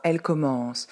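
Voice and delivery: ordinary delivery, nothing marked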